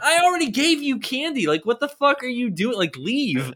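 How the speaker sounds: loud, high-pitched indignant rant; exasperated scolding. Retold or imitated. Homeowner to a kid still hanging around, exasperated scolding. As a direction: protest in outraged disbelief, then order him off.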